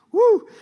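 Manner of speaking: high, loud "woo"; short excited whoop; celebratory whoop. Real, not acted. Preacher cheers the healed man's testimony, whooping.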